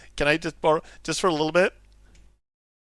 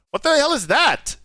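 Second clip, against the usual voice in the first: high, loud startled outburst; startled shout; 10 semitones higher, 4 dB louder than usual. Real, not acted. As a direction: blurt it out in shock. Startled by strange creature.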